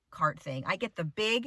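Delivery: normal talking voice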